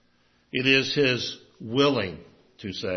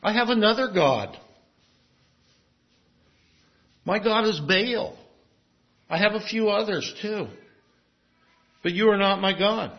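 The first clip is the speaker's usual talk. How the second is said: higher, defiant voice of a people turning away; defiant Israel rejecting God; 6 semitones higher than usual. Retold or imitated. Preacher voicing Israel telling God it has other gods, defiant and higher. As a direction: toss it off like someone done with God. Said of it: "they have said to him".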